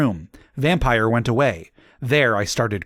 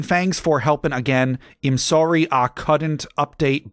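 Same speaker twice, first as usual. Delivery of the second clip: plain reading voice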